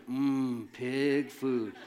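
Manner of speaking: a lightly voiced savoring hum over pig food; half-laughing mock craving; hungry longing. Starving son eyeing the pig food, mock craving with a laugh. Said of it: "staring at the pig food going".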